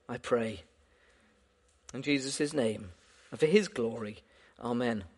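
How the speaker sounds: soft, hushed prayer voice, unhurried. Acted. Preacher praying softly over the congregation before the reading. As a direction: pray quietly and reverently before the reading.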